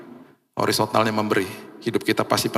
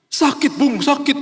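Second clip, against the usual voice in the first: high-pitched mock wail of pain; imitated crying; 13 semitones higher, 3 dB louder, slower than usual. Crying friend tells the pastor it hurts, imitated wailing. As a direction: wail it far above the usual voice, imitating a sobbing friend. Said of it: "dia lagi nangis-nangis".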